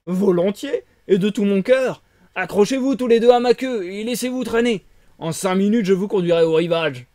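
loud, booming big fish voice; a hearty sea creature announcing itself; tuna voice. Acted. Tuna introducing himself to Pinocchio, loud and booming. Tuna offers to carry them.

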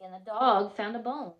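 plain reading voice

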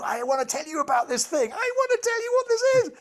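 very high, frantic insistence; going nuts. Acted. Mind's regime desperate to describe the object, comically going nuts. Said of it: "your regime goes nuts".

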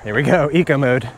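ordinary talk

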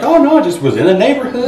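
high, breezy mock-innocent voice; nonchalant. Mom pretending she just dropped by, nonchalant. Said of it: "tried to be nonchalant".

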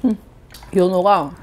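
chewing while talking; finishing a bite of sushi; mouth full. Real, not acted. Seen 1: Cheeks puffed and chewing from -4; mouth clear by 2.0.

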